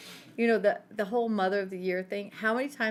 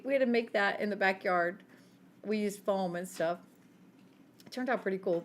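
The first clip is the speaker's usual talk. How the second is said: normal talking voice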